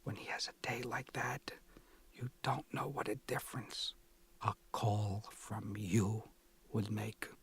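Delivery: whispered guilt trip; mother whispers her plea to her son; sotto voce whisper. A narrator's reading. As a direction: hush it like a secret. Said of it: "sotto voce still".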